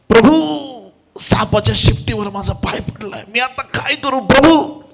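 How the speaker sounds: high, loud panicked plea to the Lord; acting out a frightened shout for help; panicked cry. Foot on a snake's tail, cries to the Lord in panic. Retells crying out after stepping on snake.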